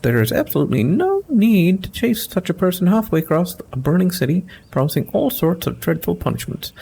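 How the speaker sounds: prim, fussy scholar defending a looter; pompous companion voice. Acted. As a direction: argue looting is charity with mock dignity.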